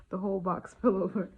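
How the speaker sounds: laughing, loud. Real, not acted. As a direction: blurt it out through a laugh. Repeats it louder, laughing.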